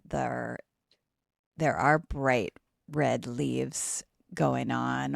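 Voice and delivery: usual speaking voice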